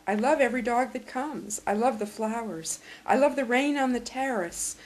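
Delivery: measured poetry recital with quoted mother; poem reading. Acted. Reads a poem aloud.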